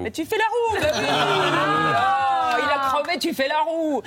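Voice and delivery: high, loud, laughing delight; laughing excitement. Acted. Telling Greg he does cartwheels, gleeful laugh.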